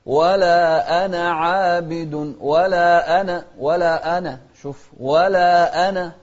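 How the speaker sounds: ولا أنا with a sharp glottal stop on أ; clear hamza. Shown as a demonstration. Teacher showing the clear hamza. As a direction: hit the أ of أنا cleanly. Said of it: "شوف الهمزة".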